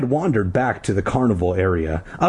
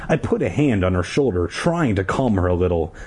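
usual speaking voice

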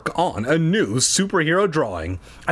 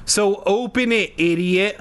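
high, impatient order; demanding; 7 semitones higher than usual. A narrator's reading. Mia to Jack at the garage door, demanding. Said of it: "Mia demanded".

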